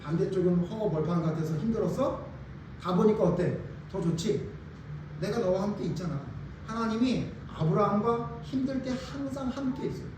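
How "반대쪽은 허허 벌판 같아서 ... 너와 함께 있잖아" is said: tender God. Retold or imitated. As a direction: speak softly, like comforting a tired friend. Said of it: "그래서".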